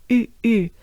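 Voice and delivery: French U in short, separate bursts. Shown as a demonstration. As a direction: say "U" with dropped jaw, small mouth opening. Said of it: "you wanna make a droopy face".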